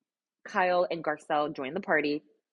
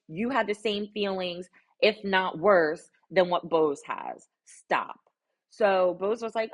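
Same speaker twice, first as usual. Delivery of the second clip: normal talking voice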